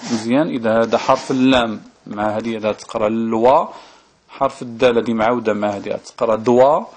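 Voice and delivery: normal talking voice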